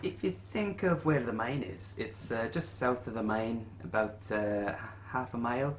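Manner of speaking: lightly voiced quest-giver Willie; lightly put-on voice, lifted at phrase starts; innkeeper Willie. Innkeeper offering adventurers payment options, lightly voiced character.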